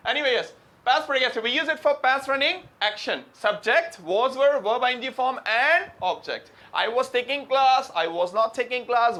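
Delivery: lecture voice. Acted. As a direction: teach the rule to the class slowly. Teacher lecturing to class. (कक्षा को धीमे, ऊँचे स्वर में पढ़ाते हुए)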